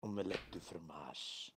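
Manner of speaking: low, soft mock French. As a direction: toss off fake French. Mock French after accent joke.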